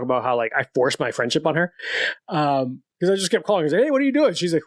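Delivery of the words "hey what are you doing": high, bright eager hello; eager greeting. Retold or imitated. To a coworker he wants as friend, high eager greeting. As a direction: chirp it like an overeager new friend.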